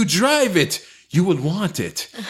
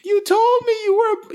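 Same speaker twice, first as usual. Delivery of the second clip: very high woman's voice; high squeaky voice; 13 semitones higher than usual. Acted. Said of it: "reading ad in put-on voice".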